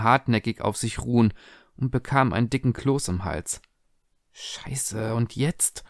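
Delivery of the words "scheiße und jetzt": much softer, muttered curse; a quiet, panicked aside; under her breath. A narrator's reading. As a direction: mutter it so the guests won't hear. Tessa panics, guests staring.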